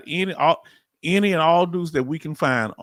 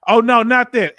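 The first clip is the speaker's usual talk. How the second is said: loud, high, horrified oh no; shocked outburst; 9 semitones higher, 10 dB louder than usual. Real, not acted. Streamer reacting to chat misreading, loud shocked cry. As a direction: recoil at the misheard word.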